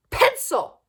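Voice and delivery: high, loud teacher's snap; snapped. A narrator's reading. Teacher snapping at the class after her slip. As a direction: bite the word off sharply at the class. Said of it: "snapped Missus Jules".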